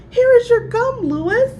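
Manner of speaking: high, loud playful voice; amused teacher. A narrator's reading. Teacher returning gum to Lewis, playful. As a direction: tease him with it.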